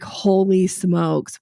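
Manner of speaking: holy smokes stretched way out; a lightly played what-have-I-done; slow retold shock. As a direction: drag it out in mock disbelief. Retold shock after paying.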